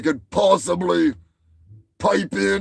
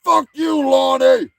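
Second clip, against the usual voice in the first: higher, louder yell at Lonnie; shouting at Lonnie, louder and higher; 7 semitones higher, 6 dB louder than usual. Acted. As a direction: bark the curse at Lonnie. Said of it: "Just like I'm saying".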